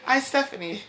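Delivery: amused casual introduction. Shown as a demonstration. Speaker repeating her everyday introduction, amused. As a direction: toss off your name while laughing.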